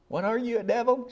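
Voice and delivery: high-pitched pretend outrage at a child; hammed-up, scandalized accusation; mock scolding. Mock scolding grandma never gave.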